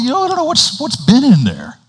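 high-pitched mock protest at the water; squeamish protest. Friend balking at getting into the lake, squeamish high-pitched protest. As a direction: squeal it out, playing the reluctant swimmer. Acting a reluctant swimmer's excuse.

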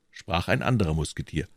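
ordinary delivery, nothing marked

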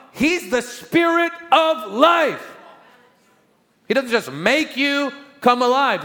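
high, loud, slow ringing preacher's cry; preaching at full force. Acted. Preacher exalting the Spirit to the congregation, high and loud. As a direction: lift it up and hold each word.

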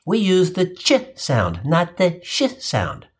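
slow contrast of "ch sound" and "sh sound"; ch versus sh. Shown as a demonstration. Teacher correcting the French boy, contrasting ch with sh. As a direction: spell out "ch" against "sh", slowly. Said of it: "for words beginning with the letters C, H".

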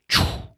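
sharp hiss of lorry air brakes; a quick burst of escaping air; lorry air brakes going off on a bend; imitated air brakes. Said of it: "which sort of go".

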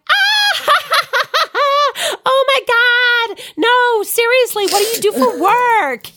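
mock American guffawing, not buying the answer; laughing American accent. American friend laughing off her answer, mock American accent. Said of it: "my accent's terrible".

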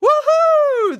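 high loud whoop of joy; cheer. A narrator's reading. Students cheering at the good news, loud whoop. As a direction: shout it like the whole class celebrating. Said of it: "The room exploded with excitement".

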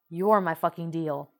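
a loud, hard snapped reply. A narrator's reading. Bakugo snapping back at Midoriya, loud. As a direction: bark the line back at him. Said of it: "Bakugo snapped back".

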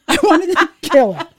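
laughing exasperation. Real, not acted. Aunt recalling niece's news of the wait, laughing exasperation. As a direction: let laughter break into the complaint.

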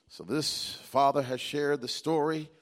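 ordinary talk

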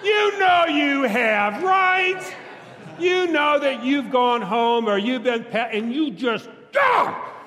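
loud, high mock blowup ending in a yell; frustrated outburst. Preacher to congregation, mimicking losing temper: loud frustrated yell. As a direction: act out snapping at home, then yell.

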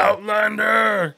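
very high, very slow loud call; Cookie Monster voice. Retold or imitated. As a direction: stretch the shout out long. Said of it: "like, Cookie Monster voice".

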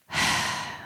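long, breathy exhale; heavy sigh. Acted. Storyteller reacts to being told how tapping works, sighing. Sigh after retelling friend's explanation.

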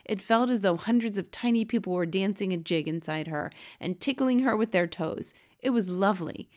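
ordinary delivery, nothing marked

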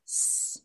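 "s" as a drawn-out hissed sound, not the letter name; isolated letter sound. Shown as a demonstration. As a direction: sound out "s" as sss. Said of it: "You wanna say the sound".

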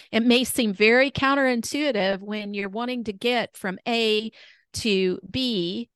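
ordinary delivery

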